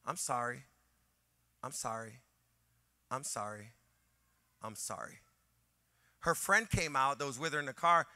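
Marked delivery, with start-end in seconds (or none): 0.0-5.2 softer and lower, fading with each repeat; sheepish apology. Retold or imitated. Rude woman apologizing to the pastor, fading into a murmur. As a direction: mumble it ashamed, quieter each time. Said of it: "this is what she said five times".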